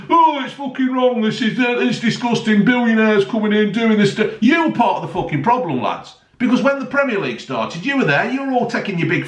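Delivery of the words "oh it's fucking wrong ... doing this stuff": mock pundits. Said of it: "they're going".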